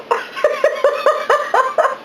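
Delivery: high, light giggle; happy giggle. Real, not acted. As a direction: giggle with delight. Happy after first bite.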